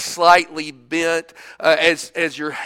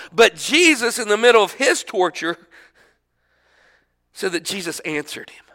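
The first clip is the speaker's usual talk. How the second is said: ordinary talk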